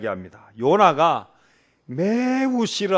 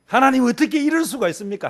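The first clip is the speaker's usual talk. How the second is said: much higher and louder, blurting out at God; raw protest prayer; 9 semitones higher, 5 dB louder than usual. Shown as a demonstration. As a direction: cry out unpolished like a hurt believer. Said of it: "원색적으로 해도 괜찮습니다".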